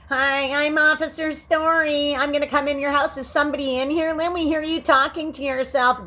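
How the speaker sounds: high, mocking police officer voice; mocking a cop. Mocking the cops who barge in, high mock officer voice. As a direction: parody a smug cop at the door. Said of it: "That's how they talk".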